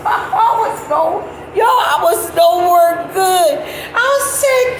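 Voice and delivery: real giggling, barely getting words out; laughing hard. Real, not acted. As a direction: talk high and slow through laughter. Cracking up while telling.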